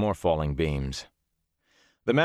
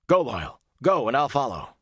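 high, forceful urging; urgent command; 6 semitones higher, 3 dB louder than usual. A narrator's reading. Rand sending Loyal out of the fire, urgent. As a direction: send him on ahead, urgently.

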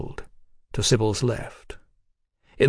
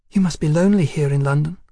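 quick, higher-pitched woman's line; blurted; 4 semitones higher, 3 dB louder, faster than usual. A narrator's reading. Sybil desperate to avoid another silence, blurting it out. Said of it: "Sybil blurted".

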